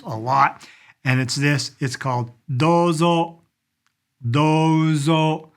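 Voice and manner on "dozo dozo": DOZO with the first O held long; long O sound. Shown as a demonstration. Teacher showing how dozo is said, long O. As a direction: stretch the first vowel of dozo. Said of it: "It's got a, a long O sound".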